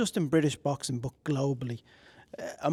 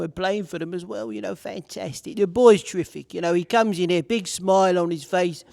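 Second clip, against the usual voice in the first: Harry Redknapp impression; 2 semitones higher, 3 dB louder than usual. Interviewer signing off with an impression of a West Ham legend, rambling praise. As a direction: ramble warmly like an old West Ham boss praising his boxer. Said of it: "I'm gonna end it with one impression".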